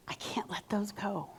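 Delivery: hushed whisper of a client who can't let go. Retold or imitated. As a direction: breathe it out, barely there. Client voicing her clutter excuse.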